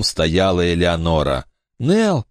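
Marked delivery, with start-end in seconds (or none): 1.8-2.3 weak call. Acted. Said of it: "слабо позвал Брэндон".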